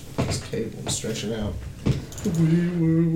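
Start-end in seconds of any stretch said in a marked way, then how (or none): 2.4-3.2 mock siren wail; siren noise. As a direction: wee woo like a siren. Imitating siren.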